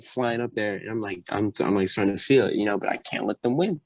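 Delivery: normal talking voice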